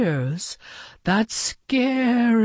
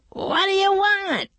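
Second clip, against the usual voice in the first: high, raised call across the field. A narrator's reading. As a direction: call out to the batter. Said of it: "he called to Beaver".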